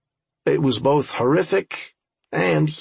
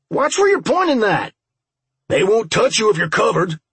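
high, raised boss's yell; 9 semitones higher, 4 dB louder than usual. A narrator's reading. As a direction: yell it at the team from behind. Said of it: "the boss yelled from behind us".

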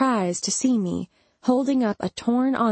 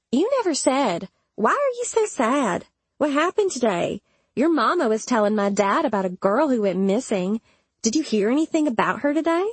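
a hint of a higher, questioning character voice; lightly voiced questioner; 5 semitones higher than usual. A narrator's reading. Imogen quizzing narrator about a missing girl, lightly voiced higher.

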